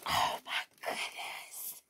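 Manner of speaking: slow, breathless whisper of amazement; awed whisper. Real, not acted. Vlogger overcome on first seeing her shoes, awed whisper. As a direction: whisper it in sheer awe.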